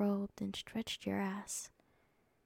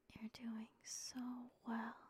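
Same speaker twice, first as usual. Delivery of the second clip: slow, much softer whisper; tender hush; 3 semitones higher, 11 dB quieter, slower than usual. A narrator's reading. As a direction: soothe him gently, slowly. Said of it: "I whispered before kissing you".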